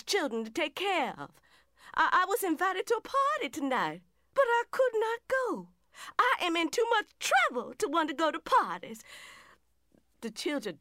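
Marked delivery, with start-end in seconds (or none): 1.9-9.0 lonely wife close to breaking; distressed. Acted. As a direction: pitch jumps higher, voice pained. Wife's distressed letter to absent husband.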